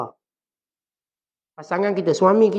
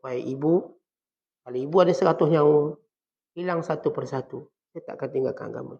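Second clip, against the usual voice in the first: put-on respectful child's voice. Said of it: "Dia cakap dengan bahasa seorang anak".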